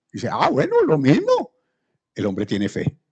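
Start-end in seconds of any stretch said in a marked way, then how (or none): 0.3-1.5 much higher, casual voice; high, easygoing father. Acted. Father shrugging off Jesus' absence, pitched way up. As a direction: toss it off lightly, way up high.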